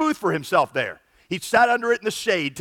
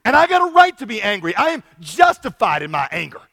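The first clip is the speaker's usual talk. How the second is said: higher and louder, furious retort; explodes back; 4 semitones higher, 6 dB louder than usual. Retold or imitated. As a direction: blow up at God, fully defiant. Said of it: "he explodes back to God".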